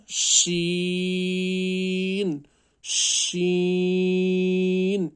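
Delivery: شین held long, chanted high and loud; teacher chanting a long شین; stretched recitation. Shown as a demonstration. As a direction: draw out شین in recitation style. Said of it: "اس کو بھی کھینچنا ہے".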